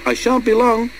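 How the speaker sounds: high, sing-out engine reply; high-pitched answering call; whistled reply. A narrator's reading.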